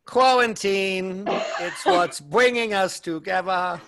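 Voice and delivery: slow, drawn-out mock wedding officiant; lisping clergyman parody. Host parodying the Princess Bride wedding, slow clergyman voice. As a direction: intone it like a pompous, lisping clergyman.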